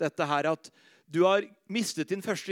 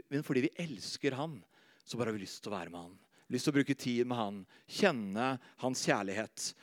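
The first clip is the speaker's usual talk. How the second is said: much softer and lower, intimate; tender hush; 7 semitones lower, 6 dB quieter than usual. Acted. Preacher to congregation about loving Jesus, tender hush. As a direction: draw the listeners close.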